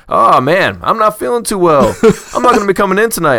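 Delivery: bright, high and quick, not sick at all; cheerful not-sick voice. Retold or imitated. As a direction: say it happily, no fake sick voice. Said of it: "He's like".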